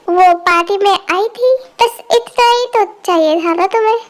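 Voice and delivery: usual speaking voice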